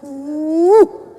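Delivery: pent-up groan of exasperation; exasperated inward "uh". Acted. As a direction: let out a frustrated "uh". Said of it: "Dalam hati saya".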